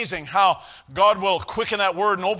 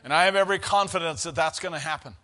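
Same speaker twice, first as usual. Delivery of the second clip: normal talking voice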